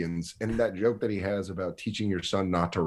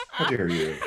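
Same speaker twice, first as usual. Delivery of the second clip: lower, half-hearted repeat of the scold; low mock scolding; 4 semitones lower than usual. Man repeating the mock scold to his partner, lower and half-hearted. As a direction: drop it down, mock stern. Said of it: "a light punishment".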